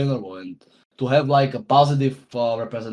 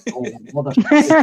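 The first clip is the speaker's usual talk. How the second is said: Australian accent, higher; Aussie catchphrase; 10 semitones higher, 4 dB louder than usual. Shown as a demonstration. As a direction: toss off the Aussie line again in a higher voice. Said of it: "you've just done some accents".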